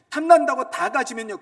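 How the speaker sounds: fervent peak of the sermon; preaching peak. Acted. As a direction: shout 탐난다고 at the congregation. Repeated louder and higher.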